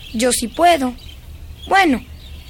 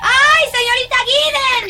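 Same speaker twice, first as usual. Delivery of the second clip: put-on high, excited child's cry; 9 semitones higher than usual. Girl thrilled to show governess new beetle, excited cry. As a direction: burst out with delight at the find.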